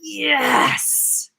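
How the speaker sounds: a high, emotional yes; elated yes. Retold or imitated. Coach voicing the inner yes of having it, elated. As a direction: say yes to yourself with joy. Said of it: "and you're like".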